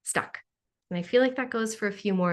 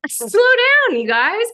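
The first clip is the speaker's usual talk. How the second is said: mock call; 12 semitones higher, 9 dB louder than usual. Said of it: "And I'm like".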